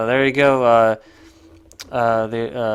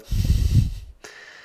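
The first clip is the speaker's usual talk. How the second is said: short unvoiced-sounding burst of laughter; loud, derisive chuckle; scornful laugh; 7 dB louder than usual. Real, not acted. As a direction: laugh at the absurd wording. Amused by article's wording.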